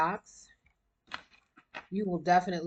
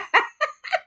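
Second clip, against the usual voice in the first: high squealing laugh; 6 dB louder than usual. Real, not acted. Laughing mid-sentence.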